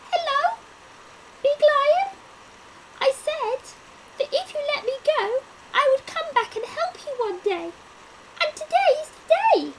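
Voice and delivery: very high, fast, eager mouse voice; squeaky mouse. Acted. Mouse eagerly tells lion it came to help. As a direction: chatter quickly like a proud little mouse.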